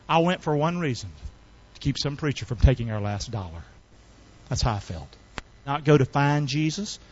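low, soft recollection; hushed testimony. Real, not acted. Hushed testimony after shouted quarrel.

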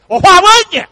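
high, loud, drawn country voice; high loud country delivery of the brother's catchphrase; brother's country twang. Retold or imitated. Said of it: "he says it like this".

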